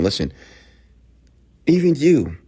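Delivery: normal talking voice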